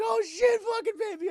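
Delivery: high, loud mock-panicked mom; frantic mother. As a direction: cry out as the desperate mother. Mother cries out to lost child.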